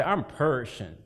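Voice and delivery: imitated rural Southern accent, PERISHING squeezed to "pershin'"; grandma's country voice. Preacher quoting his grandma from the yard, country accent. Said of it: "in her country voice".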